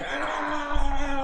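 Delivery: high yelp of pain, laughing through it; a high retold ouch; mock pained cry. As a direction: yelp like you just got hit. Beaten up in his bed.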